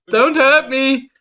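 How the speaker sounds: high, slow mock fear; mock terrified. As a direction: plead like a scared patient. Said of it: "I'm like".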